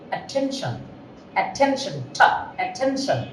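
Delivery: অ্যাটেনশন with a breathy aspirated t, like ঠ; soft, breathy puff on the t of অ্যাটেনশন. Shown as a demonstration. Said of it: "টির ওখানে একটা stress আছে". (অ্যাটেনশন, ট-কে ঠ-এর মতো)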